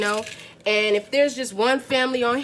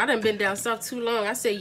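ordinary talk, nothing marked

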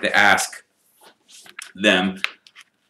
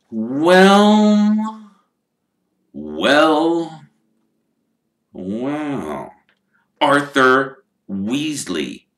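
slow, drawn-out sneer, starting louder and higher; 6 semitones higher, 3 dB louder, much slower than usual. Acted. Rich wizard greeting his rival, slow and sneering. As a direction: savour each word like a smug rival. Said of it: "sneering in just the same way".

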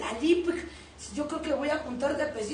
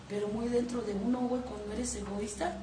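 ordinary delivery

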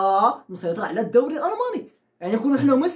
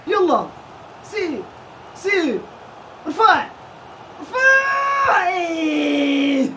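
long drawn-out yell at the players; shout; 5 semitones higher, much slower than usual. Real, not acted. Gamer yelling at his team, long drawn-out shout. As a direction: stretch the shout out at the top of your voice.

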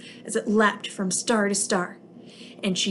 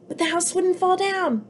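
much higher, delighted; triumphant; 9 semitones higher than usual. A narrator's reading. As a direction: announce the surprise with glee. Triumphant story climax.

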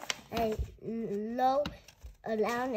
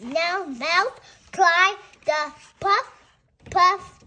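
louder, bouncy read; excited storytelling; 4 semitones higher, 8 dB louder than usual. Acted. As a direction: puff it out with energy. Reading picture book to child.

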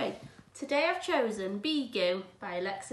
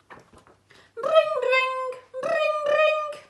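mock phone ring; 13 dB louder, much slower than usual. Imitating a ringing phone.

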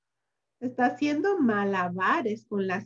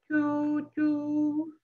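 steady choo choo; train choo choo. Acted. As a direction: chug like a toy train. Train imitation.

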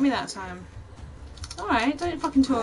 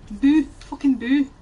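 mock boo; 6 dB louder than usual. Gamer jeering at skeleton enemies.

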